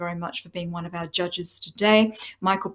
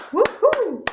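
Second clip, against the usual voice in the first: high-pitched two-note "woohoo"; cheering whoop; 4 dB louder than usual. Real, not acted. Host to the runners-up after congratulating them, high cheering whoop. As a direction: let out a high, celebratory whoop.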